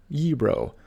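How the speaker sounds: gyro said YEE-ro; correct pronunciation. Shown as a demonstration. Teacher showing viewers the right way. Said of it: "often mispronounced as gyro or gyro".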